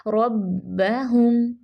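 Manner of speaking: रब्बहुम very slow with heavy rolled रॉ and held doubled बा; slow tajweed. Shown as a demonstration. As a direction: stretch रब्बहुम, stop on the बा. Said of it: "ये ध्यान रखना है".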